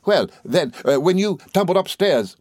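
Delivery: high, flustered gentleman; excitable friend. A narrator's reading. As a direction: begin a sheepish explanation eagerly.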